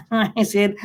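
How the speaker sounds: ordinary talk, nothing marked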